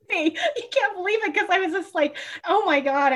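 high, fast overjoyed cry; thrilled squeal. Retold or imitated. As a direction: squeal with sudden relief. Retold joy at extra month.

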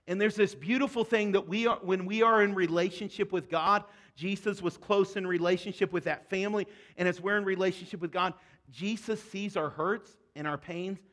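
normal talking voice

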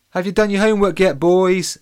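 loud, high call from the kitchen; lightly voiced mum calling upstairs; Mama G calling. A narrator's reading. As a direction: call the boys like a bossy mum.

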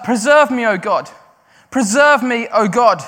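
crying out. Retold or imitated. Preacher acting David's cry in the cave. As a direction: beg God loudly for rescue. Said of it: "is crying out. He's like".

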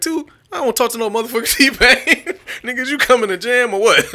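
high, loud dismissive voice. Retold or imitated. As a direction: laugh while brushing him off. Imitating [name] dismissing [name].

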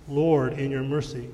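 normal talking voice

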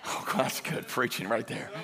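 usual speaking voice

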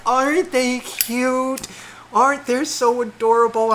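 very high, drawn-out gushing; mock cooing. Storyteller mimicking partner photographing bears, high cooing.

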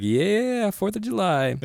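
high, soft, stretched-out yeah; mock holiday cheer. Showing off his Fourth of July outfit, mock cheer.

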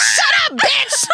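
high, loud imitated shriek; over-the-top retold screech; mock screaming fit. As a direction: scream it like someone throwing a fit, hammed up.

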